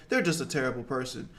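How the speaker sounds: ordinary talk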